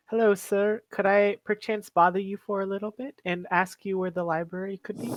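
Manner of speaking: affected, over-polite character voice; trying to sound smart. Acted. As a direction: politely put on educated airs. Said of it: "What's wrong with your voice?".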